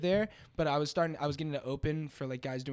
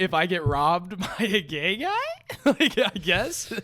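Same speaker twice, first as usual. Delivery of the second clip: higher, louder, laughing through words; 4 semitones higher, 7 dB louder, slower than usual. Real, not acted. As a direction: giggle through the punchline. Laughing at own joke.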